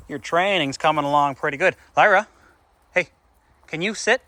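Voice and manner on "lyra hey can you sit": calling the dog. Real, not acted. Training the puppy to sit.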